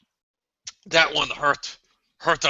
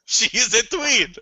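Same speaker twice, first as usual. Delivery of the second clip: high mock voice; 6 semitones higher than usual. Repeats line higher.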